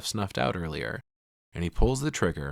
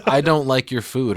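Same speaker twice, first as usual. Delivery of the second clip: yelling; 5 semitones higher, 7 dB louder than usual. Retold or imitated. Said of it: "She yells".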